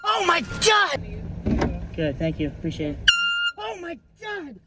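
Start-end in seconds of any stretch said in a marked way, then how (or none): 0.0-1.0 mock startled cry. Pranking the drive-thru worker, mock startled cry. Fake scare on horn. 3.6-4.6 mock startled cry. Pranking the drive-thru worker, mock startled cry. Fake scare again.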